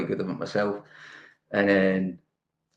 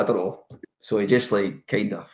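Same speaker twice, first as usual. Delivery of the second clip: usual speaking voice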